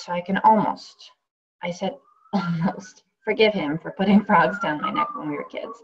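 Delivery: wry, lively first-person performance, full of asides; radio play monologue. Acted. Actress reading a radio play aloud, wry and lively. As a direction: play the injured woman talking straight to the audience.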